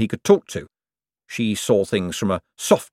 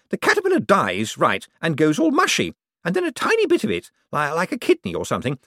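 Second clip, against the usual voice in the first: shrill demands pitched way up; Poochie's voice; 10 semitones higher than usual. Acted. To Cosmo, a greedy high-pitched rich woman.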